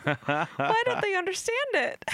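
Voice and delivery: high, pleading voice; woman's voice. Retold or imitated. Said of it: "a woman is heard saying".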